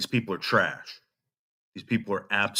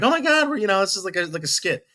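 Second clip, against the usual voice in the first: mocking Ruby; 9 semitones higher, faster than usual. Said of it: "it's not like Ruby's like".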